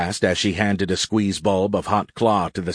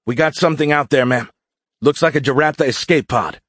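higher, louder, quick excited report; 6 semitones higher, 4 dB louder than usual. A narrator's reading. Pilot telling the colonel of an escape pod, excited. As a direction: report the find with eager urgency. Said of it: "Derek called excitedly".

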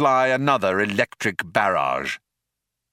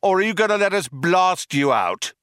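high, raised cop call; cop shouting; 10 semitones higher, 3 dB louder than usual. A narrator's reading. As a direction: call out the demand. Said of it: "shouted one of the cops".

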